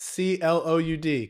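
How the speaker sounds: letter by letter; spelling out. Shown as a demonstration. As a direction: spell it out one letter at a time. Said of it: "Can you spell that?".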